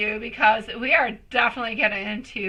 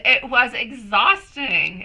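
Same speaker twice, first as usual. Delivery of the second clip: ordinary talk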